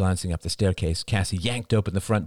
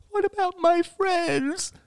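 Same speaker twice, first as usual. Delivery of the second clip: put-on high, slow wailing little boy; boy sobbing over his friends, high and slow; sobbing child; slower than usual. A narrator's reading. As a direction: sob it out like a small child. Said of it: "sobbed Ben".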